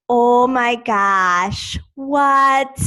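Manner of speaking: put-on drawn-out, loud Rochester, New York accent. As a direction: imitate a friend's Rochester accent with long open vowels. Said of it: "and she's always like".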